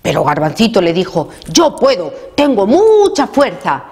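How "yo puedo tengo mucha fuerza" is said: higher voice, mildly boastful; insist, stretching the word "mucha"; insistent child. A narrator's reading. Garbancito assures mamá he can carry it, insistent child voice.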